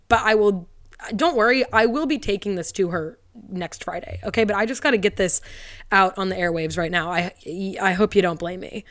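usual speaking voice

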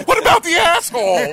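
put-on high, loud girlish quote; mock girl voice. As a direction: squeal it like an eager girl. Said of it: "and she's like".

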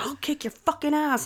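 champion cursing, imitated. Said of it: "she did it like a champion".

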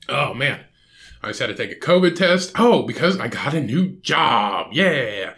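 ordinary talk, nothing marked

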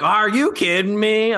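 high, drawn-out mock outrage; slow, incredulous TV-host impression; Nancy Grace. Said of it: "Nancy Grace is like".

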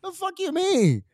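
high, slow, indignant comeback; offended mock outburst. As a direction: drag it out like you can't believe it. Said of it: "I was getting offended".

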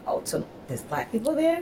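high-pitched, pleasantly surprised query; mock astonishment, rising at the end; surprised question. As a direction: imitate someone amazed to find out. Retold reaction of curious people.

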